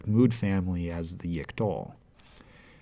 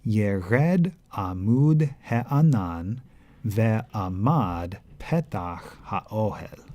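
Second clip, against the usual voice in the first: slow, careful reading voice; unhurried scripture reading; Hebrew reading; slower than usual. Shown as a demonstration. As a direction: read the verse deliberately. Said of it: "Let's read it".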